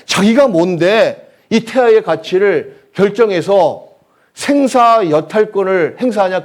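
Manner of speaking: loud, high, indignant preaching. Real, not acted. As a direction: thunder it at the congregation in outrage. (목청 높여 질타하는 설교)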